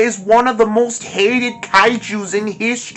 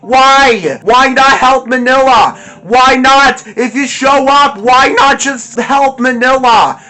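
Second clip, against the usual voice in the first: shouting in fury, higher and louder; real angry rant; 4 semitones higher, 4 dB louder than usual. Real, not acted. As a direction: explode at how bad the movie is. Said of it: "I'm just too angry talking about this movie".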